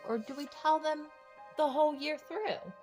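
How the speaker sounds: ordinary delivery, nothing marked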